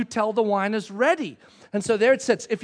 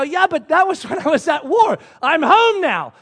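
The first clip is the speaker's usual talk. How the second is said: loud, higher-pitched mock exasperation; exasperated protest; 6 semitones higher, 7 dB louder than usual. Returned soldier acts out protesting to his wife, exasperated. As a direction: plead your case as the baffled husband.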